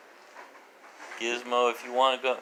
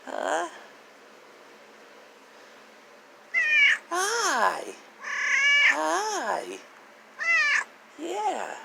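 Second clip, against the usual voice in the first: much higher, sing-song pet voice; high pet talk; slower than usual. Real, not acted. Owner greeting her dog Sugar, high cooing pet voice.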